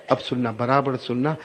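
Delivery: ordinary delivery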